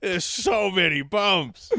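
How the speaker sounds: slow, high, loud wail of dismay; mock panic at too much text; overwhelmed reader. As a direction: blind diner overwhelmed by the Braille menu. Blind reader overwhelmed by menu.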